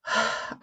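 a quiet unvoiced sigh; soft sigh. Willow sighing as she gives in to the spirits. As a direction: let the breath go softly, no words.

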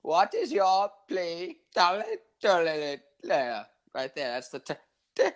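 high, slow, botched British accent; English accent turning Indian. Shown as a demonstration. Friend trying an English accent that comes out almost Indian. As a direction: attempt British and slide towards Indian. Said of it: "That was almost Indian".

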